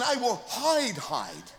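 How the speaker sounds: preacher doubling "hide" to show the Hebrew repetition; doubled hide. Shown as a demonstration. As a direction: echo "hide" a second time, falling away. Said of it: "there's two hidings here".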